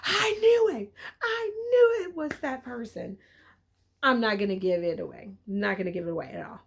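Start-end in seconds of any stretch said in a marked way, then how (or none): 0.0-3.2 acted eureka over the plot twist; triumphant realization. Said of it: "you're like".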